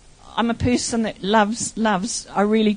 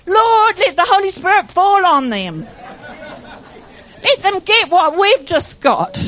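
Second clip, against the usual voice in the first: excited prayer; 5 semitones higher than usual. Retold or imitated. Retold prayer over firemen.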